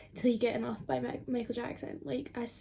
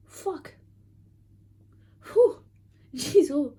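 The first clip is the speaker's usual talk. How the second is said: startled outburst; 4 semitones higher, 9 dB louder, slower than usual. Real, not acted. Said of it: "That is intense".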